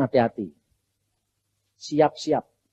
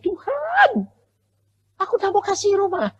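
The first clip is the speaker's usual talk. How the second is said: put-on much higher, childish whining plea; crying like a child. Preacher retelling how he begged God, higher mock childish crying. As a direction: wail up high like a hurt little boy. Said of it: "saya nangis seperti anak kecil".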